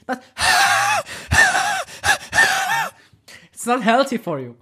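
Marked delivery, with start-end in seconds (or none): 0.3-2.9 very high screeching laugh; mock someone's piercing laugh; mocking imitated cackle. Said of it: "please, stop laughing".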